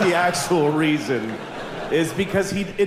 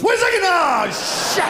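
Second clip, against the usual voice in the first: panicked fish; 13 semitones higher than usual. Pretend. Fish yelps as it gets flushed away. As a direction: shriek like a fish jolted awake. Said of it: "it looks like he goes".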